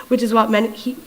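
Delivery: usual speaking voice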